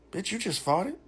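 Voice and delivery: high-pitched, rising disbelief; blurted incredulous question. Retold or imitated. Man to his date in the car, shocked high question. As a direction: blurt it as a high, retold accusation. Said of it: "I said it just like that".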